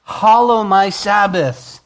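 high, loud declaration of God; fiery pulpit proclamation; proclaimed command. Acted. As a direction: boom out the Lord's decree. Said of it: "He says".

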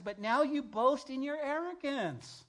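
high, loud and slow scripture rebuke. Real, not acted. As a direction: thunder the verse at them. Reading James's rebuke.

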